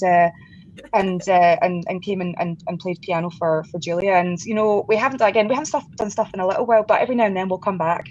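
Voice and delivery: ordinary talk, nothing marked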